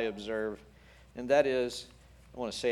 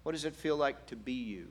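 very soft, tender questioning; hushed and caring; gentle whisper; 7 dB quieter than usual. Acted. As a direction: ask quietly, leaving room to answer. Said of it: "the gentle whisper".

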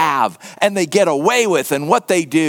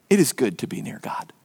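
soft whispered quote; a hushed, reverent whisper; 8 semitones lower, 6 dB quieter, faster than usual. Real, not acted. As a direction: whisper the verse low and tender. Quoting the psalm softly.

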